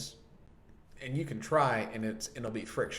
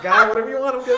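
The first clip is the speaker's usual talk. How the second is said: much louder, high fast laughing rush; laughing quote; 13 semitones higher, 16 dB louder, faster than usual. Retold or imitated. Friend jokes about careful praying, laughing. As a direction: laugh through the mock prayer. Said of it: "I'm like".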